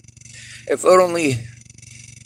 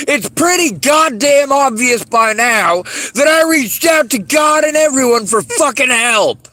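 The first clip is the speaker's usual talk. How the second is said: building from loud to a higher, louder shout; furious shout; 7 semitones higher, 3 dB louder than usual. Real, not acted. Ranting at those who ignored his abuse, shouting in fury. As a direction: yell it out, fed up after years.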